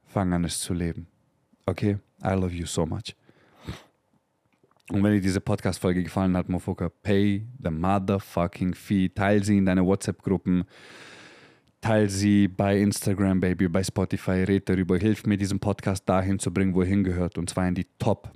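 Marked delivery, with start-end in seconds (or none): none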